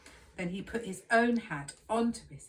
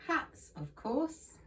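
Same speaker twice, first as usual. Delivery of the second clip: knowing tease; 8 semitones higher, slower than usual. A narrator's reading. Teasing storyteller answer.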